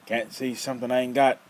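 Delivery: put-on loud, rough old man snapping a question; gruff challenge. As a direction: call the kid out, sharp and loud. Nefer's rough voice.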